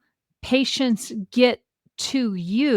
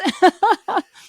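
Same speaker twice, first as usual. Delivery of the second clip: laughing; 8 semitones higher, 5 dB louder than usual. Real, not acted. Laughs at own remark.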